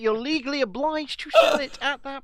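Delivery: high, loud outraged protest; indignant. Real, not acted. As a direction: insist hotly on the rule. Indignant about price law.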